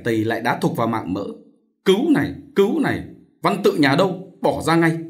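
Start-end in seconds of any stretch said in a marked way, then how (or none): 1.8-5.0 high-pitched angry jeering; mock the cry, then demand sharply. A narrator's reading. Chồng vừa đá vừa quát. (quát giận dữ)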